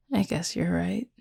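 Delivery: ordinary delivery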